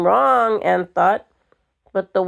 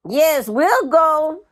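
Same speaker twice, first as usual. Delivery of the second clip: booming; 7 semitones higher, slower than usual. A narrator's reading. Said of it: "boomed Mattie from the doorway".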